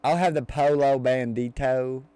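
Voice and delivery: slow, overplayed Southern drawl on Spanish; mock a thick Southern accent ordering in Spanish. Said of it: "real thick Southern accent and you try to".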